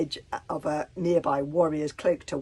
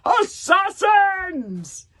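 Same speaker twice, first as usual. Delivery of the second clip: huge scream of alarm; 14 semitones higher, 12 dB louder, much slower than usual. A narrator's reading. Warlord screams at the intruder in his soup, full shout. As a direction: scream the warning at the table. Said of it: "he screamed".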